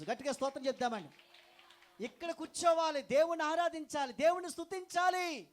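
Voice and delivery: very high, loud climactic preaching; full-force preaching. Acted. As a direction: build to a roaring climax. (గట్టిగా ఉద్రేకపూర్వక ప్రసంగం)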